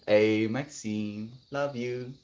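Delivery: ordinary talk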